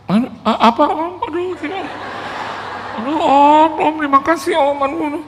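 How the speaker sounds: very high, flustered mock-crying; imitated sobbing thanks. Pastor overwhelmed by donation offer, imitated sobbing thanks. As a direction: stammer your thanks through a put-on sob. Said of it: "saya lihat dia nangis".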